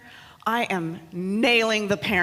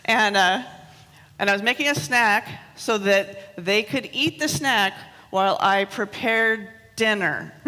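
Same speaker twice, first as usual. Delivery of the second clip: ordinary talk, nothing marked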